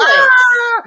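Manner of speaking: high, loud mock scream; child's shriek. Imitating daughter seeing her doll punched, shrieking. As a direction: shriek like a horrified little girl. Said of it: "Jeanette would go".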